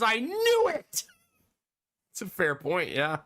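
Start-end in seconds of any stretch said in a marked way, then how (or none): 0.0-1.0 slow, higher, tearful hammed-up cry; play-sob it for laughs; mock weeping. Mock weeping over Mufasa.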